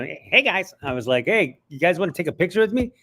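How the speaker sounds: hey guys like a polite nerd. Retold or imitated.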